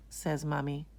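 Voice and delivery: plain reading voice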